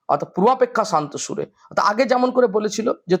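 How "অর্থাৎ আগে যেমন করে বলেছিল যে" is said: normal talking voice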